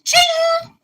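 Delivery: very high, loud "ching"; piercing cash-register ching; imitated cash register. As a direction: ring it out like a till. Imitated cash register for box office.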